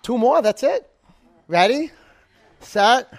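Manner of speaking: loud, high coach cues to push the class; loud coaching calls. Real, not acted. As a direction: call it out to drive the last reps.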